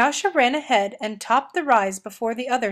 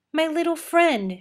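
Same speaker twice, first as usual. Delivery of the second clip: delighted exclamation; 6 semitones higher than usual. A narrator's reading. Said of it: "she exclaimed".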